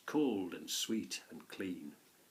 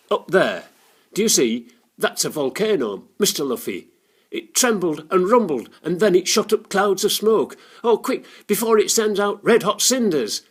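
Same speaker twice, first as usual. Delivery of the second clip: terrified; 9 semitones higher, 15 dB louder than usual. A narrator's reading. Terrified girl explains the volcano.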